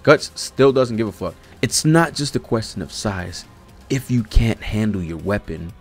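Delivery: normal talking voice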